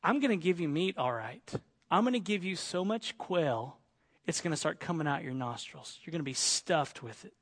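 a dry, quiet warning; God answering the complainers, low and dry. Retold or imitated. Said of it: "God tells them".

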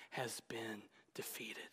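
hushed, intimate whisper; preacher whispering an urgent aside to the congregation. Real, not acted. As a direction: lean in and whisper it. Whispered sentence ending.

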